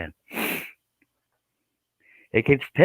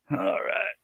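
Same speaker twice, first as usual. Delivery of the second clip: put-on whiny child voice; 10 semitones higher, 9 dB quieter, slower than usual. Whimpering reply after being scolded.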